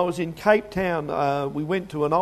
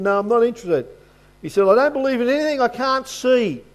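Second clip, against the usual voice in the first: higher and louder, a stubborn retold no; defiant refusal; 7 semitones higher, 6 dB louder than usual. Bedridden atheist rejecting the preacher, defiant and louder. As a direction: push back hard, refusing to listen.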